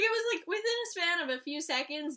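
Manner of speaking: ordinary delivery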